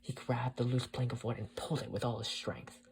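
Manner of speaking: ordinary delivery, nothing marked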